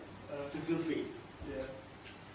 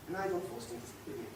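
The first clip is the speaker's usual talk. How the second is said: soft, quicker, voice dropped right down; quiet aside; 13 dB quieter, faster than usual. Acted. Man defending himself to his companion, quietly sheepish. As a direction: slip "sleep with me" in softly and fast.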